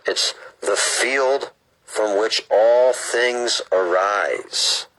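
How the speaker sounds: slow, measured read-aloud; book reading. Acted. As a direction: read it out deliberately, phrase by phrase.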